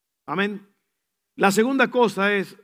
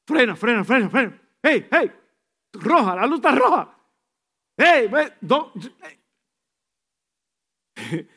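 louder, higher urgent yelling; backseat driver panic; 4 semitones higher, 6 dB louder than usual. Retold or imitated. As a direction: yell at the driver to brake. (pasajero gritando al chofer, más alto y fuerte)

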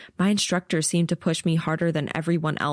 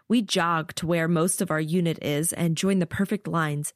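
ordinary delivery

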